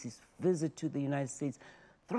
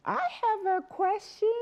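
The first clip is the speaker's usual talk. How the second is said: put-on high, small child's voice asking; imitating a little boy in the audience. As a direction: pipe up like a young child. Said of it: "one child said to me".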